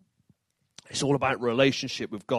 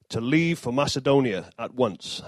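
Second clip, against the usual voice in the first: usual speaking voice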